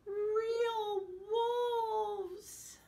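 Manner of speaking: high, loud, frightened cry; scared cry. A narrator's reading. Mocha the dog hearing real wolves, high frightened cry. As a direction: cry out in terror.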